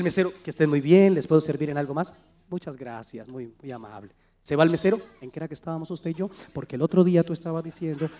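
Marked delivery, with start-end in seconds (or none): 0.4-4.1 soft and much quieter, unhurried and gentle; calm hushed talk. Pretend. Couple talking over a problem at a restaurant, hushed and calm. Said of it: "mira cómo se comunican, no levantan la voz". 5.2-8.1 soft and much quieter, unhurried and gentle; calm hushed talk. Pretend. Couple talking over a problem at a restaurant, hushed and calm. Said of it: "mira cómo se comunican, no levantan la voz".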